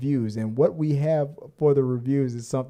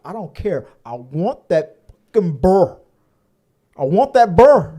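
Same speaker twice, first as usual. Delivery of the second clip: imitating a Southern woman, louder and higher at the end; Southern accent; 4 semitones higher, 9 dB louder than usual. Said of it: "she has a very deep Southern accent".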